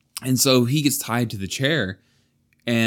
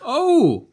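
pained cry; 5 dB louder than usual. Acted. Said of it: "cried ... as though they had been hurt".

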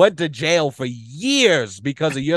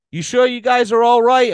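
raised, louder voice of a checking cop; high, loud probing question; retold concerned questioning; 8 semitones higher, 6 dB louder than usual. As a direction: imitate a doubtful cop, pitched high and loud.

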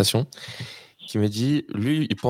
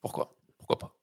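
whispered; 8 dB quieter than usual. Real, not acted. Teasing question to co-host.

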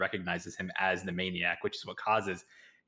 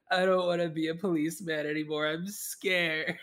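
higher frightened voice; higher mock-scared plea; scared whine; 9 semitones higher, 3 dB louder than usual. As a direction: whimper like a spooked kid. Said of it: "panicked and scared".

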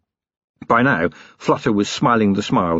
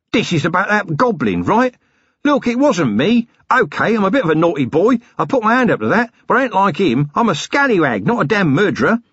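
high, panicky petty crook; aghast protest; 9 semitones higher than usual. A narrator's reading. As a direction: backpedal in a rush of nerves. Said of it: "Flutter looked aghast".

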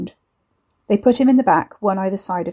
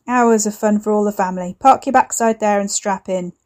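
jovial gangster uncle; 4 dB louder than usual. Acted. Voices Uncle Barry.

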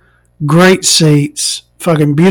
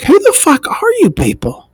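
much higher, incredulous outburst; mock outrage; 13 semitones higher than usual. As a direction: squeal at the snobby menu. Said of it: "this whole menu is just like".

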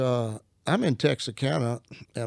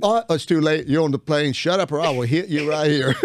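joking threat, louder; mock threat; 3 semitones higher, 6 dB louder than usual. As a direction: play the menacing husband in jest. Mock threat to wife on plane.

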